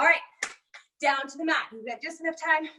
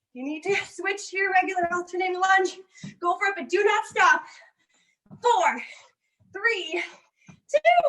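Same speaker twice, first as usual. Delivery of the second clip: higher, pushing trainer calls; fitness coach cues; 5 semitones higher than usual. Acted. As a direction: drive them through the last round. Coach cueing the final round.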